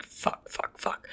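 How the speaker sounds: hushed, near-voiceless swearing; muttered retold panic. Patient recalling her throat closing up, muttered panic. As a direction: swear softly under your breath.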